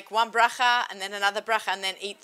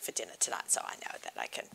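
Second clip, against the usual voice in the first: whispered aside; whispering; 13 dB quieter, faster than usual. Real, not acted. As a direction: drop to a whisper. Aside about eating meat tonight.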